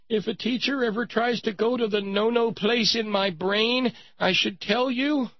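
high-pitched mock little-girl voice; crying little girl. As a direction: play a wronged child tattling to her parents, very high. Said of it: "And she said".

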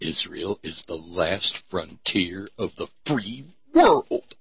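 low, soft, weighty syllable-by-syllable delivery; Charlton Heston emphasis. Shown as a demonstration. Demonstrating the slogan in Charlton Heston style. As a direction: lean on each syllable like an epic hero. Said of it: "say it with that Charlton Heston sort of emphasis on the syllables".

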